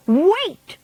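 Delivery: high shrieked wait. A narrator's reading. Witch in the back row halting everyone, shrieking. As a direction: shriek it out sharply. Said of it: "shrieked one of the witches".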